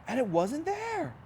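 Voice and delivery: high, astonished reveal; surprised storyteller; amazed. A narrator's reading. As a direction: gasp it out like a discovery. Empty tomb surprise.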